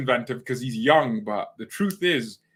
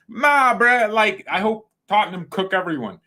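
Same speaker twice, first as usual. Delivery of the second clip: excited; 8 semitones higher, 5 dB louder than usual. Real, not acted. Hyped about Tottenham beating everyone.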